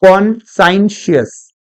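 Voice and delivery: कॉन्साइंशियस slowly, "kon-sine-shus"; read as written. Shown as a demonstration. Teacher prompts students to read, कॉन्साइंशियस slowly as kon-sine-shus. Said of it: "पढ़ के बताएं".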